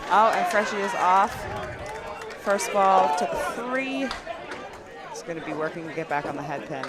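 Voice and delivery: bowling call, lively then hushed; live play-by-play. Acted. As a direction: call each ball as it rolls.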